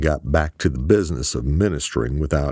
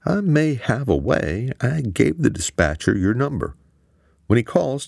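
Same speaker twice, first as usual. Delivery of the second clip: suggesting; 10 semitones higher than usual. A narrator's reading. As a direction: lay out the idea carefully. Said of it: "Quan suggested".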